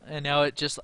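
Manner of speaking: normal talking voice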